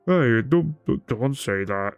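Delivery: lightly voiced bear protesting; bear's voice, by mistake. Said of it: "I was confusing to the bear, uh, voice acting".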